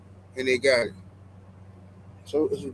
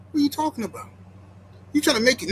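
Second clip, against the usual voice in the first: ordinary talk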